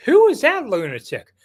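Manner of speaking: astonished outburst. Retold or imitated. Golf reporter seeing a cart fly from a sand trap, high loud astonished cry. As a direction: blurt it out in disbelief.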